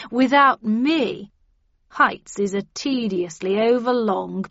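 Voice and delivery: loud, self-important boasting; arrogant star character. Acted. Star of the book tells them he'll do as he pleases, haughty. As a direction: declaim it like a vain leading man, defiant.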